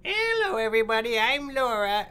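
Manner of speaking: put-on high, loud old granny; Granny Laura voice. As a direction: call out like a cheery grandma. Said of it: "Would you do my granny voice for me?".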